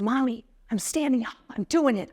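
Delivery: a hint of an excited kid announcing his plan; eager little boy. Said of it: "Colton turns to me and goes".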